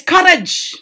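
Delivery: high-pitched, energetic cry; rousing exhortation. Acted. Teacher quotes Ulysses urging his friends, rousing cry. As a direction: call it out to rally weary companions.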